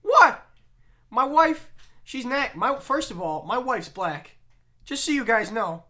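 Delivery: high, loud indignant stand-up rant; Bill Burr voice. Retold or imitated. Bill Burr on stage to crowd, outraged high rant. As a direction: defend yourself to the crowd, outraged. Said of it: "He's like".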